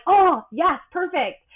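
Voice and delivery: high, loud delighted approval; delighted reaction. Retold or imitated. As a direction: burst out thrilled, pitched well up. Reacting to clients getting their tone.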